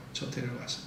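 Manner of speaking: worn-out soft plea to God; faint plea. Acted. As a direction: fade 저 데려가세요 to a weary murmur. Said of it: "하소연하면서 기도합니다".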